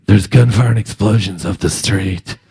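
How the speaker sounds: low, flat-calm mock report; matter-of-fact. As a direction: state it like ordinary news. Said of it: "she says it so matter-of-factly".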